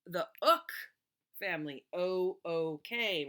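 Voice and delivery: ook said loud with a rise; slow spelled-out ook family; stressed ook. Shown as a demonstration. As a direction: hit ook loud and rising. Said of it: "we're talking word families".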